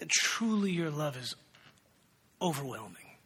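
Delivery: soft, slow, reverent prayer voice. Acted. Pastor leads the congregation in prayer, soft and reverent. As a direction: pray quietly with awe.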